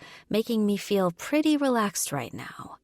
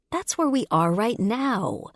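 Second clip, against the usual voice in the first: higher-pitched disapproving reminder; 5 semitones higher than usual. A narrator's reading. Woman reminding her drinking partner of the game's rule, disapproving. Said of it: "I remind him with disapproval".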